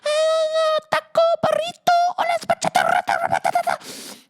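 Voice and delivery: very high, shouted, hammed-up fake Spanish accent. Mocking the wife's put-on Latin act, shrieking high fake accent. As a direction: screech the Spanish words like a cartoon. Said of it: "and she's like".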